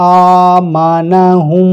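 আমানাহুম with the vowel held long and slow; drawn-out vowel. Shown as a demonstration. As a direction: stretch the vowel in আমানাহুম over one alif. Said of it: "এক আলিফ ধরে টানবে".

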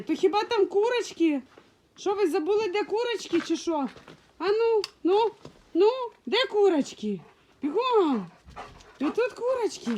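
high sing-song chivvying; pet talk. Real, not acted. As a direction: hurry the pets along, teasing. Urging pets toward the hens.

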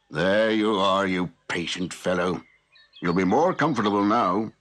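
gently praising the patient horse; soothing, fond tone; kindly to a horse. A narrator's reading. Blacksmith talks to horse.

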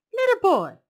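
high, loud surprised call; exclaiming. Acted. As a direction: exclaim it in delight. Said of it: "Omu exclaimed".

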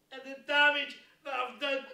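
ordinary talk

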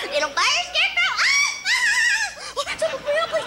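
high, loud cackling screech; shrieking witch. Acted. Melting witch screeches at Dorothy, high and loud. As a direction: screech as she melts.

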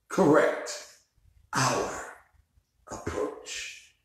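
fading question. Real, not acted. Pastor leaves the congregation with a question, fading to a breath. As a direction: let Who will correct our approach die away to nothing. Sermon closing repeated question.

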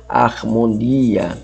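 "Harmonia" slowly, with a breathed English H at the start; aspirated H. Shown as a demonstration. Teacher showing Greek rough breathing, H sounded. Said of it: "sempre antecede com um H na leitura".

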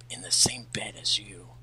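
unvoiced trailing whisper. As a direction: drop to a whisper. Shadow's cold refusal.

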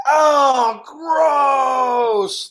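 high, loud cry of disgust; disgusted outcry. Acted. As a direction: shout it grossed out. Disgusted reaction in story.

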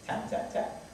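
hushed, voiceless little taps; tiny whispered knocks; soft knocking imitation. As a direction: act out a discreet tap on the door. Imitates tapping on the door.

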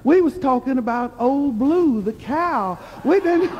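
imitated Granny suddenly understanding, rising into laughter; dawning realization. Granny explaining they meant the cow, dawning realization. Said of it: "she looked puzzled for a few minutes".

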